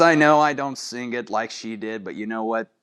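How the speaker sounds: ordinary delivery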